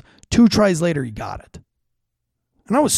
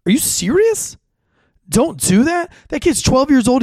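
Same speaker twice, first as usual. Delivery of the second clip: higher, louder indignant outburst; outraged disbelief; 8 semitones higher, 4 dB louder than usual. Real, not acted. Father ranting to listeners about a lax dad, higher and outraged. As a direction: throw up your hands, appalled.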